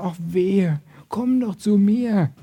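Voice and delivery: soft, slowing, caring plea; tender invitation. Acted. God calls us with open arms, tender invitation. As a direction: gently draw them in with open arms. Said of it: "Die Gnade ist mit offenen Au".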